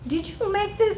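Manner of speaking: high-pitched mock grown man voice; Larry. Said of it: "grown man voice".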